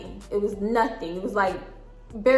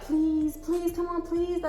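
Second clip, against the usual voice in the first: high, soft pleading; small high mock plea; begging; 6 semitones higher, 6 dB quieter than usual. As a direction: imitate begging through a wall.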